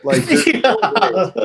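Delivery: high loud slow disbelief about the commenter; incredulous. Real, not acted. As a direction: stretch out the no-way in amazement.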